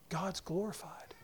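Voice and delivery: a sudden hushed whisper, no voice. Real, not acted. Preacher whispers to the congregation, hushed and unvoiced. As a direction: breathe it out as a secret.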